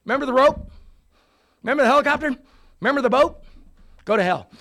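loud, high, mocking reproach; exasperated God. God scolds the man in heaven, loud and exasperated. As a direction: rub his nose in every missed chance.

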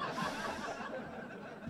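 airy, voiceless chuckle; real laugh at the memory; breathy laugh. Real, not acted. Laughing at his own story.

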